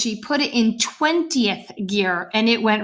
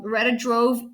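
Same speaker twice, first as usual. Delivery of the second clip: plain reading voice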